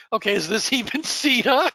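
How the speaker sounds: high, incredulous double take; baffled, half laughing; surprised disbelief. Retold or imitated. Stunned by her flawless accent.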